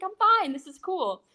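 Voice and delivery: reassuring grandma about the bite, excited; chirpy reassurance. Retold or imitated. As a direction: brush off the worry with glee. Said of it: "super excited still".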